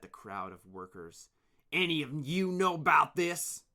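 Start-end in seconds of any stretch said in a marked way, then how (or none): 1.7-3.6 loud, higher demand. Acted. Leader demanding answers from the workers, loud. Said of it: "he demanded".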